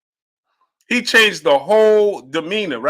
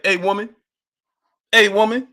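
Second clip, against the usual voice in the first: higher-pitched mocking call of hey woman; lightly voiced boxer's catcall; Clubber Lang taunt; 6 semitones higher than usual. Streamer retells Clubber Lang calling out, teasing. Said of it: "that's what Clubber Lang, he said".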